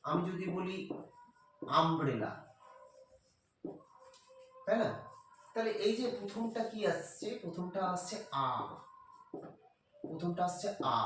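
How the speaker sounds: ordinary delivery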